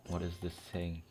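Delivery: ordinary talk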